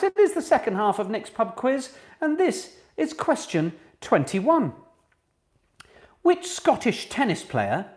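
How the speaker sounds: high, slow, deliberate question reading; quizmaster announcement. Real, not acted. Host reads the question to quiz players, as a deliberate quizmaster. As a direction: read it out with measured, spaced emphasis.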